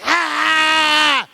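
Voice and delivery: high yell at the top of the lungs; full-lung yell. Retold or imitated. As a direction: let out a long, high release yell. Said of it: "just top of my lungs".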